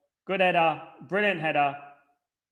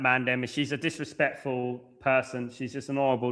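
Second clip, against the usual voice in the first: usual speaking voice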